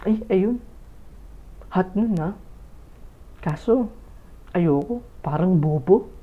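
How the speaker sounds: a hint of a vain girl sizing up classmates; pretty girl's inner thoughts. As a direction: late girl picking a seatmate, softly voiced scheming thought. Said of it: "ganito yung nasa isipan niya na babaeng the looks".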